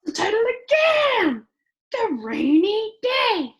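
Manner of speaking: bursting to say it first; excited puppet. Acted. Puppet eagerly shouting the title to the kids.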